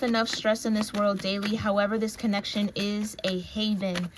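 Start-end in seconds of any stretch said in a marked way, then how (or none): none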